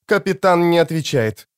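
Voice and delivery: a touch of hardness, holding back panic; hard, restrained. A narrator's reading. Warden hiding her panic from the guards, hard and restrained. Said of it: "Голос надзирательницы был жёсткий, слишком сдержанный".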